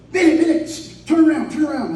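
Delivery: high, excited imitation of a passenger; retold urgent call. Friend spotting dumpster from the car, excited urgent call. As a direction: cry out suddenly to stop the driver.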